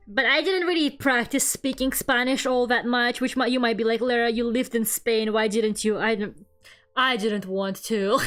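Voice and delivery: usual speaking voice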